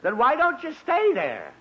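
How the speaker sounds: fed-up father snapping at his son; exasperated snap. Acted. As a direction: bite the words off at the boy.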